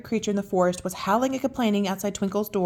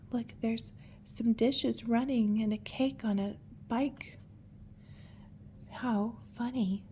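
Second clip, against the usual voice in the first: whisper; 8 dB quieter, slower than usual. Real, not acted. Hushed aside while reading.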